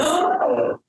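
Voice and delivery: sharp imitated gasp for air; a quick high gasp of relief. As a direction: gasp in like a first real breath after weeks. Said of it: "But I instantly went".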